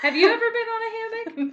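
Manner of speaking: high, laughing question; laughing disbelief. Real, not acted.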